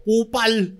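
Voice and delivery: high-pitched mock yell; retold shout. Cyclist retells being yelled at by a passing rider, mock shout. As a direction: call it out as the angry rider's insult. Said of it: "sinigawan ako".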